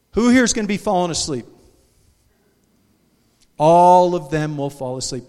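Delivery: booming pulpit emphasis; loud preaching call. Real, not acted. Preacher to congregation, booming.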